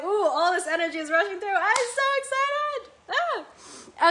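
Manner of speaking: high, loud breathless gush of excitement; excited rush. Real, not acted. Host describing energy rushing through her, thrilled. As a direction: let the energy spill over, high and loud. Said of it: "I'm like".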